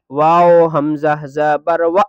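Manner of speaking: و cut short with a hard glottal catch; و snapped short with a hamza catch; abrupt glottal stop. Shown as a demonstration. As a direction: jerk the و off sharply. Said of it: "و میں الف کی آواز آئے گی".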